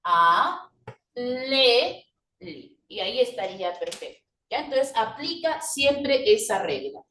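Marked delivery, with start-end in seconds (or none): 0.0-2.8 A, le, li as separate sustained syllables; alelí split slowly with gaps. Shown as a demonstration. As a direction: draw out A... le... and drop on li. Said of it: "¿Cómo te quedaría?".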